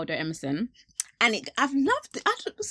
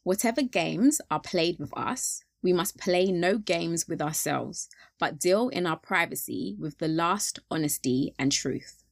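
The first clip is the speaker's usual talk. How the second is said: slower, weighing each word; slow reading; 2 semitones lower, slower than usual. Shown as a demonstration. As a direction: read the quote again slowly.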